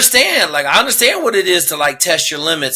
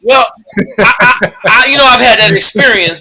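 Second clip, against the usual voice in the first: laughing loud; 5 semitones higher, 7 dB louder than usual. Real, not acted. Laughs at joke.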